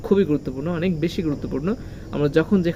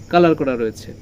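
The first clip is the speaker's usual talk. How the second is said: ordinary talk, nothing marked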